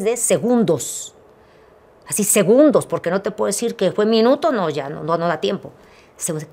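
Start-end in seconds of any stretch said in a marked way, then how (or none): none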